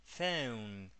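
"phone" with a drawn-out eu glide; eu diphthong. Shown as a demonstration. Teacher corrects student, "phone" with long eu diphthong. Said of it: "ese sonido es eu".

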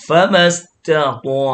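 "ฟะมัสตะตอ" with puffed "ตะ" and heavy "ตอ"; light t, thick t. Shown as a demonstration. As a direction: puff air on "ตะ", then fatten "ตอ". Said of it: "ตัวตาพ่นลม ตัวตอหนาอ้วน".

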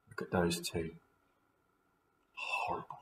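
hushed disgusted whisper; whispered aside. Acted. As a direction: whisper it to the listener. Narrator's aside to listeners.

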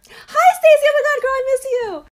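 high squealed hello; gushing fake greeting. Woman greets the friend she dislikes, high gushing squeal. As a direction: gush loudly, all false delight.

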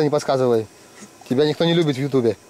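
ordinary delivery

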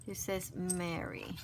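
usual speaking voice

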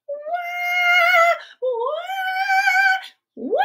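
high, loud fake bawling; the storyteller hams up Andrea's crying for the kids; mock toddler wail. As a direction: howl like a frustrated little girl. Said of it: "She would cry".

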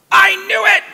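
high, loud furious yell; furious scream. Retold or imitated. Jonah screaming at God in fury. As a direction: hurl it at God in a rage. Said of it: "screams at God".

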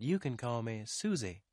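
lightly voiced young woman; friendly office girl. A narrator's reading. Susie tells the detective not to be formal, friendly. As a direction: gently correct him in a girl's voice.

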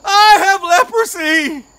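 loud, slow, breaking sung cry. Acted. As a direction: sing through tears, voice cracking. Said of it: "his voice was breaking".

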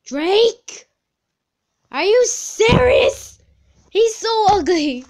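very high, loud shriek of disbelief; shocked, high-pitched yelling; outraged disbelief. Real, not acted. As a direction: shout it, appalled at the result. Appalled at her quiz result.

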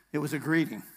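usual speaking voice

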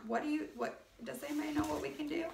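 usual speaking voice

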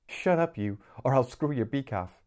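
mock threat with a wry edge; wry chuckle. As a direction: tease the parrot, half amused. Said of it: "said Dele with a wry chuckle".

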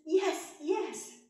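high, loud, eager yes; young queen agreeing eagerly; excited young queen. A narrator's reading. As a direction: squeal a happy yes as Rose. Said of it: "She says".

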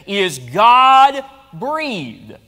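"God-breathed" drawn out very slowly, each syllable weighed; slow weighty emphasis. Shown as a demonstration. Preacher stressing to the congregation, "God-breathed" said very slowly. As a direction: let "God-breathed" land slowly and heavily. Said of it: "the word there literally says".

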